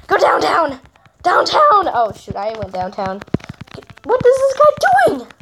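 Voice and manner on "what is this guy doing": high baffled shriek; incredulous squeal. Real, not acted. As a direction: squeal in disbelief. Baffled at player.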